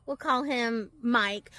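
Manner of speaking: ordinary talk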